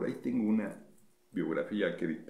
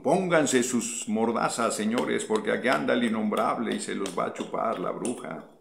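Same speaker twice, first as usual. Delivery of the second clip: mock warning; 4 semitones higher, 6 dB louder than usual. Host warning his rivals in jest, hammed-up loud announcement.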